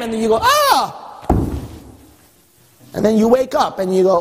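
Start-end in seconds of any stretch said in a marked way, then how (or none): 0.4-1.0 high acted yelp; mock yelp of pain. Believer hit by God's chastisement, high mock yelp. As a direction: cry out as if struck.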